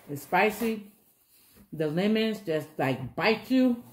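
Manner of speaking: muffled by cloth over mouth; wiping mouth while speaking; talking through a napkin. Real, not acted. Seen 2: Wipes mouth with napkin while talking; no corn or chewing visible.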